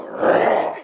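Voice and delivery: breathy voiceless growl in his face; intimidating growl. Retold or imitated. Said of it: "I do this in his face".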